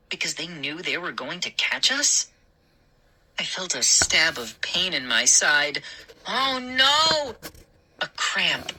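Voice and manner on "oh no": dismayed. A narrator's reading. Sudden cramp while running.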